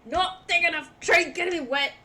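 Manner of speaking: loud, high irritated rant; distant angry grumbling. Acted. Soaked Sky cursing the tree in the rain, loudly annoyed. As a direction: curse loudly as if from far off. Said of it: "you hear in the distance".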